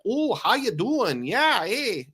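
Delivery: high, sing-song attempt at a Mainer accent; funny Mainer accent. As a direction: put on a slow Mainer accent that drifts Minnesotan. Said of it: "in that funny, like, Mainer accent".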